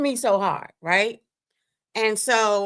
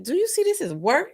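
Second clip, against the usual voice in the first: high exasperated; 9 semitones higher than usual. Retold or imitated. Retold argument with abuser.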